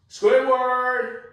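calling for Squidward next door. Acted. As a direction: shout the name across the yard.

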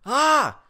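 short high, loud scream; startled scream. Real, not acted. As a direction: scream out in alarm. Startled during play.

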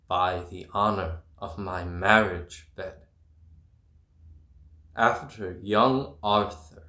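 ordinary delivery

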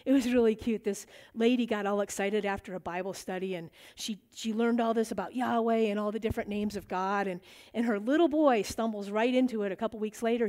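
ordinary talk

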